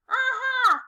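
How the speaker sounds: high, loud triumphant cry; shout. Acted. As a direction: crow it out, you've solved it. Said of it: "we all shouted".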